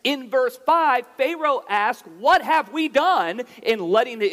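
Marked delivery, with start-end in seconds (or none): none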